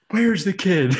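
high, loud hammed-up shout; playful cop yell; mock officer demand. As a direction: burst through the doors demanding answers. Officer kicks doors open, demands.

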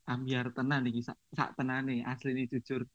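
ordinary talk

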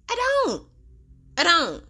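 high, insistent "I don't"; sharp high protest; emphatic denial. Real, not acted. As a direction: insist it firmly, voice jumping up.

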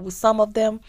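normal talking voice

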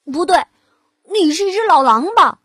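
loud accusing pig. Acted. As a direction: point the finger at the wolf.

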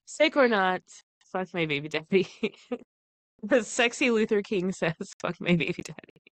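ordinary delivery

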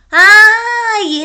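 startled cry of discovery; sudden realization. Real, not acted. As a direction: gasp it as it dawns on you. Realizes the water soaks in.

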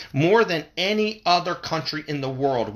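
ordinary talk, nothing marked

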